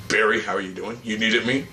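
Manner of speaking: low, put-on hero voice; Oliver. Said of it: "deeper voice".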